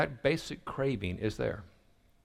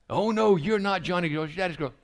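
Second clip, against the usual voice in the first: higher, louder teasing; playful giant dad; 8 semitones higher, 5 dB louder than usual. Retold or imitated. Dad teasing his three-year-old, loud and playful. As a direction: tease her like a play giant. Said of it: "Pictures a little three-year-old".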